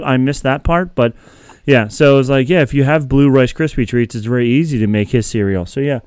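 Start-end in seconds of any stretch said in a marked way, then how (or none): none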